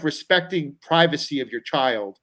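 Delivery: ordinary delivery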